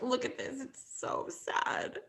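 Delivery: breathless whispered mock sob; mock sobbing. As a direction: mimic a friend crying at an ad. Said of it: "You're like".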